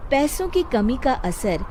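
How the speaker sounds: plain reading voice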